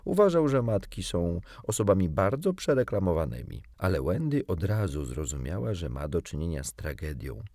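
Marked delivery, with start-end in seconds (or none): none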